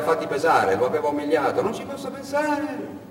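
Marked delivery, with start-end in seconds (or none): 1.6-3.0 high, slow uncle voice; the uncle handing over his savings, scolding; stern old uncle. Retold or imitated. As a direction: lecture the nephew from above. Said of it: "aveva detto".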